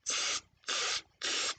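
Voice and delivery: three soft voiceless hisses, evenly spaced; monkey "tss" noises. A narrator's reading. Monkeys answering the peddler, soft hissed tss. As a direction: hiss "tss" softly, like teasing monkeys.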